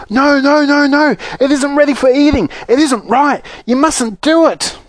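high-pitched, agitated protest; frantic pleading. Acted. Wonka frantically stopping the girl eating.

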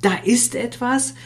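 excited. Acted. Describing joyful emotional bursts.

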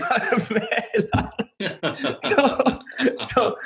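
breaking into laughter mid-sentence; laughing too hard to finish. Real, not acted. Laughing at the awkward memory.

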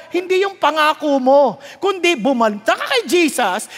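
ordinary talk, nothing marked

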